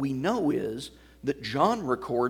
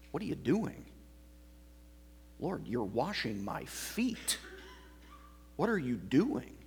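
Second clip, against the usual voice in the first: shocked disbelief; 5 dB quieter than usual. Retold or imitated. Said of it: "he said that with shock and disgust and disbelief".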